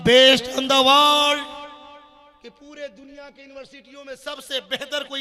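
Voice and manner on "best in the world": loud, very drawn-out English words; loud proclamation. Real, not acted. Preacher declaring Harvard the top university, loud proclamation. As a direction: stretch each word out for the whole crowd.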